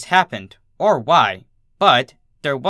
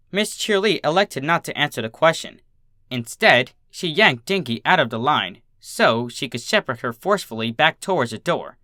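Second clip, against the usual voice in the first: ordinary delivery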